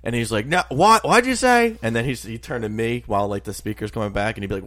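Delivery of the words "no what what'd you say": put-on high, loud old-man bark; hammed-up grandpa straining to hear; mock confused shout. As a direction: grandpa yelling back at the speaker. Grandpa mishearing at drive-thru.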